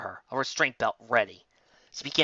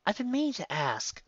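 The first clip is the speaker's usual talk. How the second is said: Twilight's voice; 9 semitones higher than usual. A narrator's reading. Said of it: "Twilight said".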